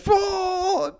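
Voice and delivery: a sharp high "pwah" burst out in laughter; high laughing splutter. Retold or imitated. Fibber bursting out at the coach, high laughing splutter.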